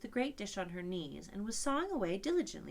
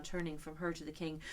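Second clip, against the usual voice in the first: ordinary delivery, nothing marked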